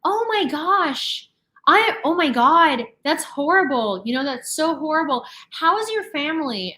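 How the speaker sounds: high, over-the-top gasp of fake horror; imitating a stranger's exaggerated pity; gushing mock sympathy. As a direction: gush like a sales clerk performing concern. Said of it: "And she's like".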